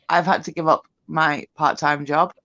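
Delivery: ordinary talk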